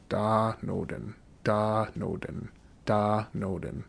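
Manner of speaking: slow, careful drill pronunciation; slow model reading. Shown as a demonstration. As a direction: say Da noden slowly for learners. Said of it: "Just run through all these verbs".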